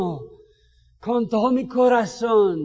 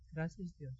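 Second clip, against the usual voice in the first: much lower and softer, barely there; timid thanks; 6 semitones lower, 18 dB quieter than usual. Pretend. Preacher mimicking a lukewarm church thank-you, soft and low. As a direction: mumble your thanks half-heartedly.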